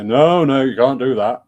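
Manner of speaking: loud northern English fish objecting; northern accent. Acted. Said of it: "suddenly got a northern accent".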